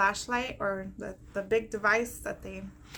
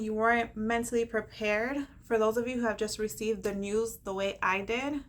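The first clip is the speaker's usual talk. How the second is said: usual speaking voice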